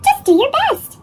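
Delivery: high, kind mother; gentle mom voice. A narrator's reading. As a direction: soothe him like a mom.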